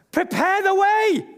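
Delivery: "Prepare the way" called out high and forceful; emphatic declaration. Shown as a demonstration. Preacher showing the congregation, emphatic call. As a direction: proclaim it boldly to your neighbour. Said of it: "a bit more emphatic about this".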